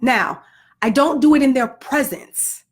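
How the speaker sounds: ordinary talk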